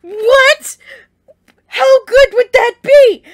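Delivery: loud, high-pitched excitement; thrilled outburst. Real, not acted. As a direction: burst out loud at a thrilling idea. Thrilled by own fan theory.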